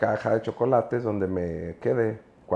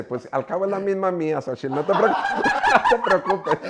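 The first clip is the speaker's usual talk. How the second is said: laughing through quote; 11 semitones higher, 4 dB louder than usual. Real, not acted. Retelling wife's joke, laughing.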